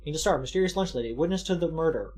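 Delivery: ordinary talk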